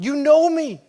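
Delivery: high, loud, rising protest; yelling at God. Retold or imitated. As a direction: shout every sacrifice up at God. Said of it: "so I yelled that at God".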